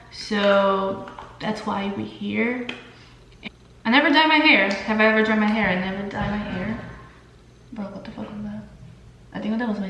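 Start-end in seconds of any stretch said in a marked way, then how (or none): none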